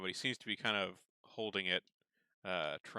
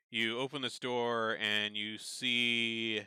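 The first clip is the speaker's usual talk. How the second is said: usual speaking voice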